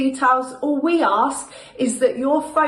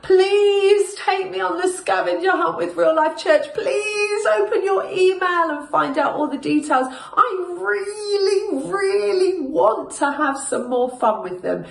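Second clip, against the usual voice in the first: whiny voice; 7 semitones higher than usual. Shown as a demonstration. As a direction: whine and beg like a child. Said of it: "practice your whiny voice".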